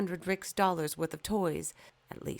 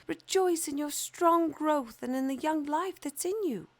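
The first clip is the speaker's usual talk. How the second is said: much higher and louder, glowing; sunbeams voice; 11 semitones higher, 6 dB louder than usual. Acted. Sunbeams urging the young fir to rejoice, high and bright-toned. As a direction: shine the words out high.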